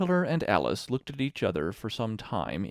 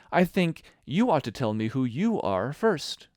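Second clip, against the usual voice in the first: firm, raised, earnest insistence; very grave; 5 semitones higher, 5 dB louder than usual. A narrator's reading. Alice irritated by short remarks, answering very gravely. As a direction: insist gravely, louder and higher. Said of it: "very gravely".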